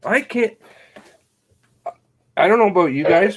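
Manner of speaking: usual speaking voice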